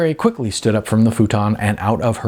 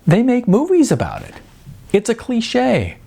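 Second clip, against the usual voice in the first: playful high; 14 semitones higher than usual. A narrator's reading. Sarah teasing, pointing at TV.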